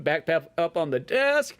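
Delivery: ordinary talk, nothing marked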